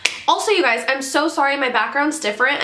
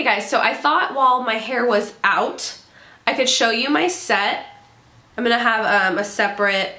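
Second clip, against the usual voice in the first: ordinary talk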